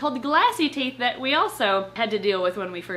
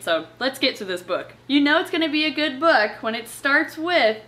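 ordinary delivery

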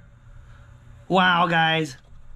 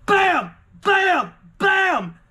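excited shouts; 10 semitones higher, much slower than usual. Real, not acted. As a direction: punch out each bam in real triumph. Celebrating the big win.

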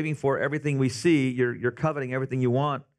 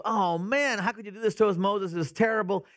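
dismayed crowd; 6 semitones higher than usual. Retold or imitated. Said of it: "The people goes".

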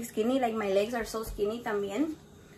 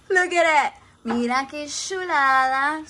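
delighted showing off; 3 semitones higher, 7 dB louder, slower than usual. Real, not acted. Admires the food.

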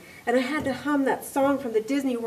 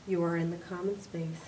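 a gentle woman speaking from above and behind; calm facility voice; 4 semitones lower, 11 dB quieter than usual. Acted. The building's voice telling Mary where she is, calm and soft.

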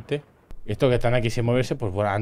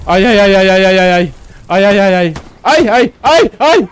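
loud, high string of frantic ays; mock panic; 8 semitones higher, 12 dB louder than usual. Streamer watching a soldier in trouble, hammed-up panic. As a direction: yelp as if everything is going wrong. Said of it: "el paniqueo".

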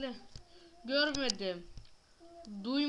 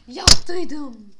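loud insisting; 16 dB louder than usual. Real, not acted. Insisting loudly.

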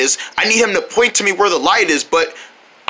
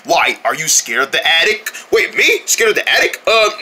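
ordinary talk, nothing marked

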